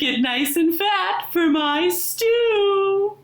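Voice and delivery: sly wolf calling out to the chicken; wolf crying out. A narrator's reading. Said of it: "he cried".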